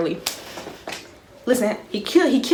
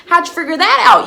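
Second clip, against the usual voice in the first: high, loud incredulous question; mock astonishment; 11 semitones higher, 10 dB louder than usual. Girl stunned a boy knows her secret, acted astonishment. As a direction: blurt it out in acted disbelief.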